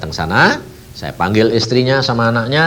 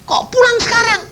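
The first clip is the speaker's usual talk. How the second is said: surprised protest. Retold or imitated. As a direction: cry out in disbelief to God. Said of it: "aku bilang". (protes kaget kepada Tuhan)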